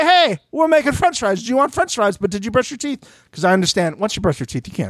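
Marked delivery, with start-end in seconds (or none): 0.0-3.0 a lightly voiced chirpy roommate offering fries; breezy, quick, higher sing-song offer; mock friendly offer. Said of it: "just coming to be like".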